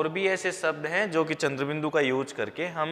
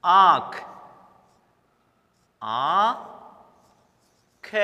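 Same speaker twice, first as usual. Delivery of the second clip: आंख stretched very long; very slow demo; 3 semitones higher, 4 dB louder, much slower than usual. Shown as a demonstration. Teacher says the word slowly for the class. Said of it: "जैसे मैं आपको बोलूं".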